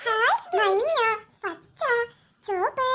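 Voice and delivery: ordinary talk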